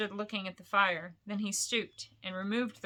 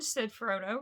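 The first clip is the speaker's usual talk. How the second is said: usual speaking voice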